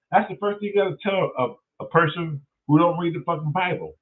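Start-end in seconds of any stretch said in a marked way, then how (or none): none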